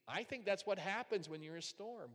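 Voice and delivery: ordinary talk, nothing marked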